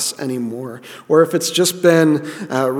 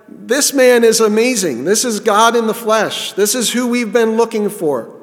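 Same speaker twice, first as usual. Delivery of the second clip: amazed apostles; 6 semitones higher than usual. Retold or imitated. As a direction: gush about him like a thrilled witness. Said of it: "They're like".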